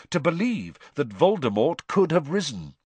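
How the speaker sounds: ordinary delivery, nothing marked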